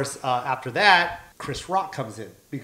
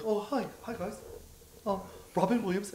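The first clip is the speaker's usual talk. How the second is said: soft, higher-pitched shy hello; meek greeting; 5 semitones higher, 7 dB quieter than usual. Retold or imitated. Famous comic introduces himself to two comedians, meek greeting. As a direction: introduce yourself timidly and quietly.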